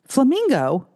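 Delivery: high, startled disbelief; surprised. Retold or imitated. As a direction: caught off guard by her own code name. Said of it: "and she went".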